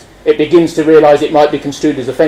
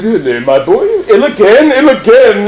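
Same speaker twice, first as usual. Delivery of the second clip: posh doctor; 4 semitones higher than usual. Acted. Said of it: "he goes".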